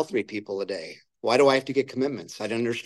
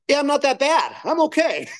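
much higher, lightly relieved inner voice; mock relief; 14 semitones higher than usual. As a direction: play it like a weight lifted, half joking. Relieved after hearing sponsor's confession.

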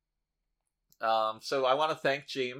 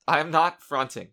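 louder, slower insistent denial; 4 semitones higher, 7 dB louder, slower than usual. Real, not acted. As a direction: lean on it, drawn out and loud.

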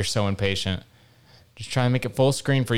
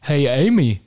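put-on [name] accent; 3 dB louder, slower than usual. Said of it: "[name] accent".

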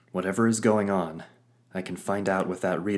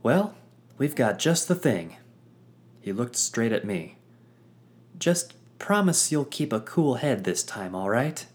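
sly, teasing sidekick; 5 semitones higher, 3 dB louder than usual. Acted. Pluck teasing.

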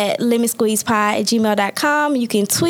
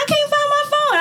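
panicked lady; 14 semitones higher than usual. Retold or imitated. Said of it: "She was like".

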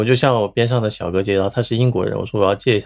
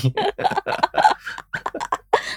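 breathy laugh; 4 dB quieter than usual. Real, not acted. Laughing at being corrected.

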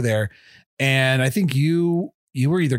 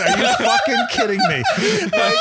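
much higher, laughing in disbelief; incredulous laughing. Retold or imitated. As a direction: squeak it out through laughter. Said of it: "standing there going".

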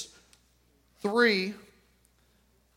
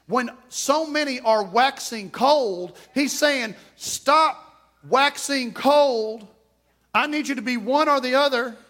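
raised, pressing pulpit voice; preaching urgency; 5 semitones higher, 3 dB louder than usual. Acted. Pastor warning the church about waxing cold, urgent preaching. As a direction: drive the warning home.

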